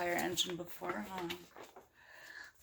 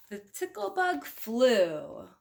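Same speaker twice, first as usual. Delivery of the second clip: ordinary delivery, nothing marked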